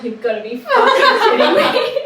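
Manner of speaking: high, loud, laughing through the words; retelling his stunned laughing reply; laughing disbelief. Retold or imitated. Guy hearing the news, laughing in disbelief. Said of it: "he burst out laughing".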